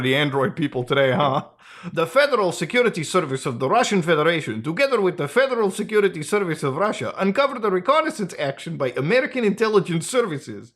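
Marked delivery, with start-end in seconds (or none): 1.9-10.7 put-on, hammed-up Russian accent; bad Russian accent. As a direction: read it out in a shoddy mock Russian accent. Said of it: "stop the shitty Russian accent".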